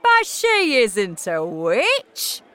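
loud, very drawn-out incredulous protest; crying out. A narrator's reading. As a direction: protest slowly at full voice, high in pitch. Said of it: "cried Mister Jenkins".

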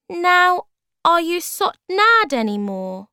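very high, loud little voice; high-pitched storybook creature; tiny creature Bob. Acted. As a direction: squeak the question out eagerly. Tiny creature Bob asks the magician.

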